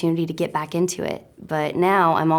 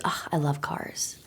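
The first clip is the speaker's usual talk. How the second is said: unvoiced, softer dreamy sigh of love; gushing whisper; 3 semitones lower, 9 dB quieter than usual. Real, not acted. Telling the viewers about her passion, breathy whisper.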